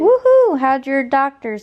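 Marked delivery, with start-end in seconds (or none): 0.0-0.6 high, loud joyful cheer; excited whoop. Real, not acted. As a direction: whoop with delight. Greeting grandmother on call.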